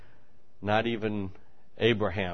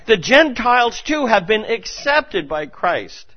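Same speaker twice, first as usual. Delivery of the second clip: high, loud, slowing preacher's cry; fervent pulpit proclamation; impassioned preaching; 7 semitones higher, 8 dB louder than usual. Acted. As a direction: lift it up to the back pews. Preaching at full force.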